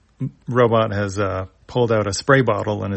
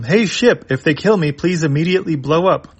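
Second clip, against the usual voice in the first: nervous urgency; 6 semitones higher than usual. Acted. Ruddock panics, orders ship.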